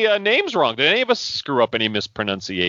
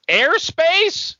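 high, outraged question; incredulous. Acted. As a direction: scoff at the word Airspace. Mock outraged old man.

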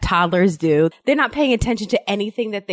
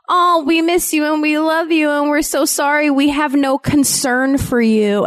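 son's sweet message; 7 semitones higher than usual. Retold or imitated. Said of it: "And he goes".

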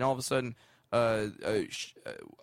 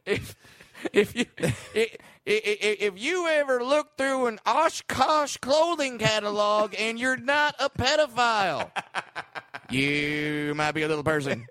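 Jeff Foxworthy; 7 semitones higher, 3 dB louder than usual. Acted. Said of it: "But in Jeff Foxworthy".

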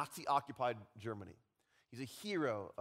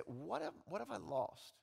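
usual speaking voice

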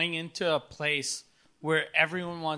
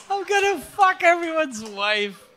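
mock yell; 7 semitones higher than usual. Echoing the drunk friend's yell.

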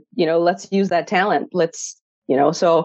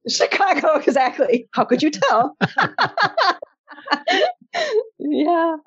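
high, laughing reply, amused at being caught; 9 semitones higher than usual. Real, not acted. Guest answering host's accent guess, laughing and high. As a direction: laugh it out, tickled.

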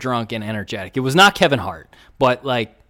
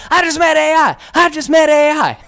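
much higher and louder giddy squeal; giddy fan squeal; 9 dB louder than usual. Retold or imitated. Fan walking away chirping about meeting Iverson, giddy high squeal. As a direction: squeal it like a starstruck fan who just met his idol. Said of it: "He's like".

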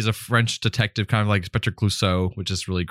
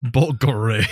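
put-on Russian accent; slower than usual. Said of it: "he's trying to say it in a Russian accent".

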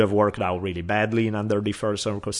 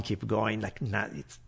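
ordinary talk

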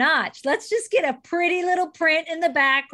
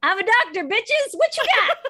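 much higher, swaggering hype shout; cocky brag; 9 semitones higher than usual. Pretend. Co-host imagining the jersey slogan as a cocky, hyped brag. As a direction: brag it like a trash-talking champ.

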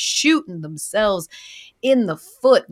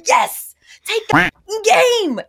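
high, slow, loud yell; exasperated yell; 14 semitones higher, 6 dB louder, much slower than usual. Retold or imitated. Fed up with the Dolphins' hesitation, slow high yell. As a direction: drag out each word in disbelief. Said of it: "And it's like".

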